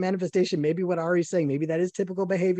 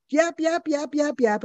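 mocking yap yap; 9 semitones higher, slower than usual. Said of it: "And she's like".

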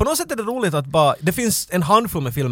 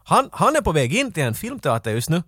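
ordinary talk

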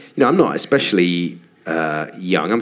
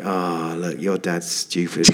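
imitating a pitying quiet voice; 5 semitones lower, 6 dB quieter, slower than usual. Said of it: "I always feel bad for him, like".

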